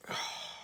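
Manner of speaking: long exasperated sigh; weary sigh. Real, not acted. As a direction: sigh heavily before beginning. Despairing before starting on Kamala Harris.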